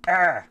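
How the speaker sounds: sharp high yelp, loud; frustrated yelp. Retold or imitated. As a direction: cry out in frustration. Mock cry of frustration.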